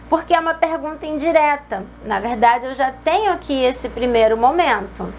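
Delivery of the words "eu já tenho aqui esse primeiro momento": normal talking voice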